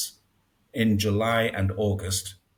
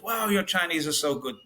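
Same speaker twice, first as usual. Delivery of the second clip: higher-pitched imitation of an amazed driver; gushing praise, pitched up; impressed; 7 semitones higher than usual. Taxi driver complimenting a foreigner's Chinese, impressed.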